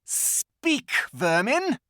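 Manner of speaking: high, loud, contemptuous command; witch queen commanding. A narrator's reading. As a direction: spit it at the creatures like a tyrant. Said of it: "she said again".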